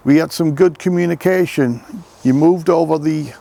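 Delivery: loud call to the spirits; calling out loudly. Real, not acted. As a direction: call it out loudly across the graveyard. Same words repeated much louder.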